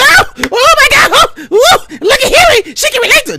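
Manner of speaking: shrill-high, loud, fake-crying rapture; mock gushing fans. Mock gushing fans squealing over Hillary, loud and high. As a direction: squeal like an overjoyed fan.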